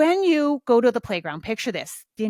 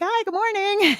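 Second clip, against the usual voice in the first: bright, high good morning; chirpy morning hello; high cheerful greeting; 11 semitones higher, 3 dB louder than usual. Retold or imitated. Her own bright reply.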